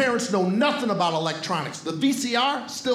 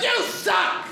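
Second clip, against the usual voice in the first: put-on high, shouted drunken heckling; drunk heckler yelling; 10 semitones higher than usual. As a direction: bellow it from the bleachers. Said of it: "yells stuff out drunk from the bleachers".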